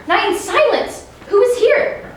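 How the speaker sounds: high, sudden startled question. Acted. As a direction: jump at finding someone. Puck startled, finding sleepers.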